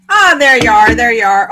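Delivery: loud happy hello; light up as the pet appears; excited greeting. Real, not acted. Owner loudly greeting her pet Harley.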